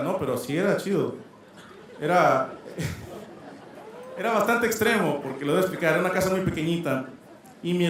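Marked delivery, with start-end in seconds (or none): 2.6-4.1 breathy laugh. Real, not acted. Laughs at his grandma story.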